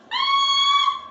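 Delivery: piercing high scream; very high, loud shriek; screaming granddaughter. Acted. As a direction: scream out loud like a playing child. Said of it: "her granddaughter just screamed".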